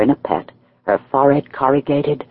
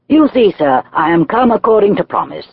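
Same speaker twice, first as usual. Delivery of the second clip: brighter, higher, a bit louder greeting; cheerful exclamation; 10 semitones higher, 4 dB louder than usual. A narrator's reading. Visitor greets his host Heathcliff, mildly cheerful. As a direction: put on a cheery front. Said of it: "I exclaimed, assuming the cheerful".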